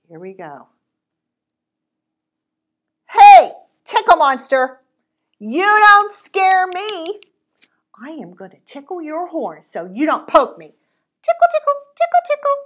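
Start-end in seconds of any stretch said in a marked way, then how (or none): 3.1-12.6 put-on high, loud teasing kid; taunting child. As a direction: tease the monster brightly.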